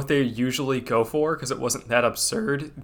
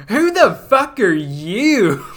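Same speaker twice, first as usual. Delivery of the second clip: slow, loud, indignant; drawn-out angry demand; mock outrage; 5 semitones higher, 7 dB louder, slower than usual. Quoting sketch, slow and loud.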